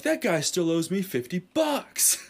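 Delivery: high-pitched laughing outrage; mock indignation. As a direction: protest an old debt, hammed-up. Acts outrage over old debt.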